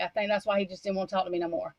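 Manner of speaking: usual speaking voice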